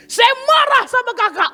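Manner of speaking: high-pitched hurt young woman, lightly voiced; a touch of an aggrieved girl; retold angry dancer. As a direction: mimic the upset dancer complaining. Dancer confronts preacher, retold.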